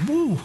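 high-pitched shouted woo; celebratory whoop. Real, not acted. Preacher leads the congregation in a shout, high celebratory whoop. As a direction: whoop it out, pitch leaping up and falling back. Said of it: "Lift your hand and shout".

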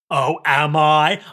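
loud, drawn-out "oh really" challenge; acted taunting reply. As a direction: stretch it out slowly and loudly, teasing. Acted comeback from smiling Anthy.

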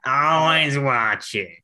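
very slow, drawn-out monster catchphrase; Roz. Acted. Co-host joining in on the joke, catchphrase impression. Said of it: "turned into Roz from Monsters, Inc".

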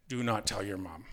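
low, soft, stern warning; strict instructions. Retold or imitated. Dad telling his son to keep a secret, retold as strict instructions. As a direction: drop the voice and lay down the rule quietly. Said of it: "my dad gave me strict instructions".

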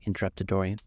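plain reading voice

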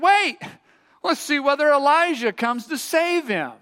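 high, loud interjection; bystanders calling out. A narrator's reading. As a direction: call to the crowd to hold off. Reads bystanders' shouted words.

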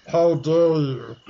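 a hint of a cold Snape; seething anger. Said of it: "demanded Professor Snape".

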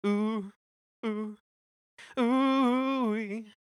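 high howling awoos; wolf howl. Retold or imitated. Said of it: "it has the awoos before it".

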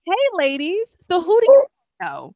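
high, loud bride's voice; imitating the bride, high and chirpy. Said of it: "She's like".

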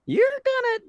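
very high, loud, drawn-out holler; mock old-timer wailing out a warning; old man's cry. Retold old man's pronouncement.

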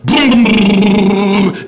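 loud, drawn-out booming engine noise; engine roaring to life. Retold or imitated. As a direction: imitate an old car engine catching, loud and high. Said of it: "after you're cranking".

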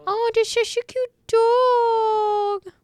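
put-on very high, slow squeaky baby talk; gasping high cooing at a dog; doggy voice. Shown as a demonstration. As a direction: squeal over a cute dog. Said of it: "Those are my little doggy voices".

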